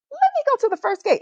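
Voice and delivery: high, quick, falsely innocent; mock-innocent high-pitched bright idea; feigned own idea. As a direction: pipe up as if it just occurred to you. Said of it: "as if it was my idea".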